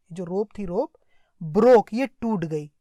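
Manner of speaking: normal talking voice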